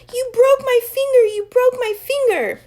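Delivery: very high, loud accusing wail; wailing accusation. A narrator's reading. Chloe accusing Max, very high loud wail. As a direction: shriek the blame at him.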